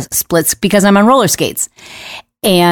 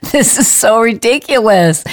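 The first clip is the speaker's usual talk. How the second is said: laughing through pain; 5 semitones higher than usual. Retold or imitated. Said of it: "like, laughing and crying".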